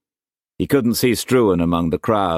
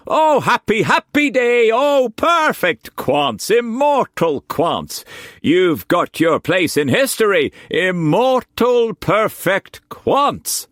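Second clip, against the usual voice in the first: high, loud ecstatic crowing; jubilant Quantz; 4 dB louder than usual. A narrator's reading. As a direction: whoop for joy. Quantz dancing with joy.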